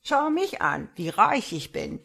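mustache twirling villain. A narrator's reading. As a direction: gloat like a preening villain. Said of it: "laut geifernd".

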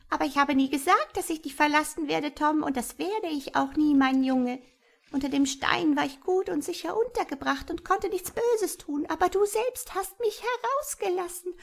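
high, squawky imp voice; spiteful, high-pitched taunting; grinning malicious creature. A narrator's reading. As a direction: gloat in a high goblin voice. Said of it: "sagte es grinsend".

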